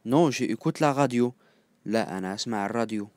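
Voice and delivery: ordinary talk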